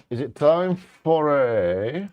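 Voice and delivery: slow, high build-up; drawn-out announcer. Acted. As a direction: stretch it out like a game show host. Building up to the recap jingle.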